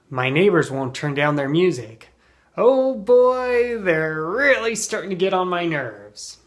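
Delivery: complain, fed up with the neighbors; rising acted annoyance; exasperated. Shown as a demonstration. Acted complaint about noisy neighbors.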